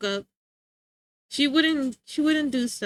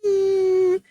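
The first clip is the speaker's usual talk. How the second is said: a high, louder whimpering "ooh" like a sad puppy; sad dog whimper; 7 semitones higher, 6 dB louder than usual. Retold or imitated. Imitating her dog's sad noise after being scolded, high whimper. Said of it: "He did sad boy noises".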